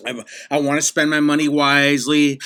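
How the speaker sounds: ordinary delivery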